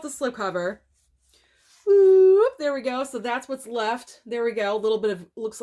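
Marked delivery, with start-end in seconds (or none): 1.8-2.5 loud, high sliding "whoop"; drawn-out, high-pitched whoop; playful whoop. Real, not acted. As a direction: let out a loud rising whoop. Pulling the slipcover off.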